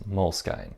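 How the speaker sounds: Moleskine as "mole-skine", rhyming with sign; long eye at the end of Moleskine; phonetic mispronunciation. Shown as a demonstration. Explaining how the word looked, Moleskine said "mole-skine". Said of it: "That was how the word looked to me".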